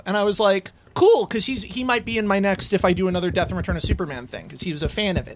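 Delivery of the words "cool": high, loud burst of delight; thrilled, louder yelp; excited cool. Retold or imitated. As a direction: jump at the invite. Excited reply to invite.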